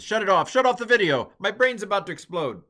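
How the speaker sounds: loud, high playful shout; mock exasperated shout. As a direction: yell it in mock despair. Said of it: "I know you're tired of this".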